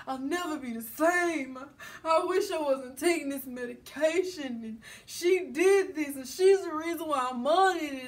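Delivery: drawn-out, high whiny lament; mock wailing. Imitating an upset man venting about his ex to Aaron, mock wailing.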